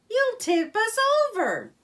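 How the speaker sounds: high, loud panicked warning; alarmed cry. A narrator's reading. Rat shouting at Mole as the boat rocks. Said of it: "cried Rat from the bottom".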